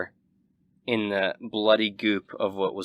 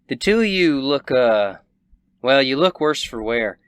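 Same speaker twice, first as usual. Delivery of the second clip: Southern rancher woman, sizing them up; 4 semitones higher, 6 dB louder than usual. Acted. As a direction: look them over in a hesitant Southern voice.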